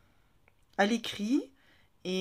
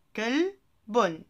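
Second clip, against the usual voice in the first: ordinary talk, nothing marked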